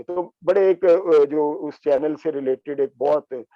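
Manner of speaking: ordinary delivery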